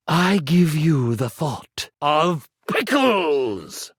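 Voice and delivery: usual speaking voice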